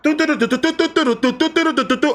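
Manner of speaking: high rapid doo-doo-doo noise; imitated clamour of kids outside; imitated kids' noise. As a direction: rattle off high-pitched nonsense syllables.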